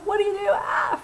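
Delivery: high-pitched, hammed-up panic with a yelp; mock freak-out. Acting coach mimics an actor getting a crying scene, mock panic. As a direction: pretend to freak out over it. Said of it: "freak out".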